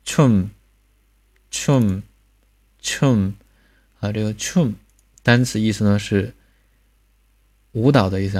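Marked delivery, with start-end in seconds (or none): 0.0-3.3 "춤" said as choom, ending in a hummed m; isolated careful "춤", falling tone; final m demonstration. Shown as a demonstration. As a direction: puff the ch, close lips to end "춤". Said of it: "触加收音".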